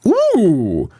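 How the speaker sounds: ordinary delivery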